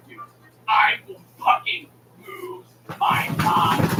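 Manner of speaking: slow, drawn-out threat; real exasperated anger. Real, not acted. Streamer venting over broken Wi-Fi, slow and exasperated. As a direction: stretch the words out, fed up to breaking. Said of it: "shit's fucking pissing me off".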